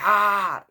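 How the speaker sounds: high, loud mock "ah" of pain; short imitated scream, falling away; retold scream. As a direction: cry out as if bitten, re-enacted. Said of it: "when I'm screaming".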